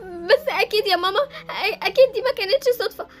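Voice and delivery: tearful, rising broken voice; crying. Acted. Daughter to mother after heartbreak, crying. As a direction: sob through the words, pitch climbing. Nadine cries after the betrayal.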